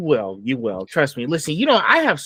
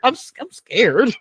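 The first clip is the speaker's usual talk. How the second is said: high-pitched retold panic; mock freaked-out; 9 semitones higher, 3 dB louder than usual. Listener reacting to colleague's kid-like voice, mock scared and high. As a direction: stammer it out high, playing up the alarm. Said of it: "I was freaking out".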